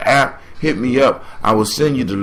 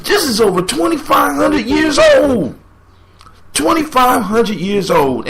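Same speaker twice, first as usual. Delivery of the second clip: fired up; 8 semitones higher than usual. Real, not acted. Fired up about the hater.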